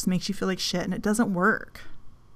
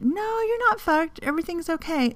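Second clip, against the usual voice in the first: sane reassuring voice; 9 semitones higher than usual. Retold or imitated. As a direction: soothe yourself like a sane inner voice. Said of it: "sort of sane voice is like".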